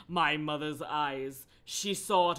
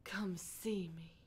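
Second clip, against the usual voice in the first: slow, soft whisper; hushed request; 13 dB quieter, slower than usual. Acted. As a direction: whisper it invitingly slowly. Voices Julia's hushed request.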